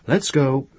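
put-on a touch of talking to a child. A narrator's reading. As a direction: coax a child along, lightly. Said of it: "as she would have said it to a child".

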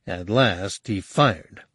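plain reading voice

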